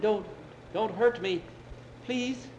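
put-on scared old man pleading; frightened plea. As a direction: plead not to be harmed, in acted fear. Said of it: "the man looked frightened".

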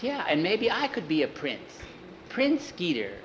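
put-on dreamy young cowhand. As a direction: toy with the idea of being a prince. Jackie looked over and frowned.

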